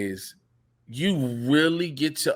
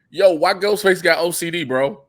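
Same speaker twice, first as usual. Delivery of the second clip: usual speaking voice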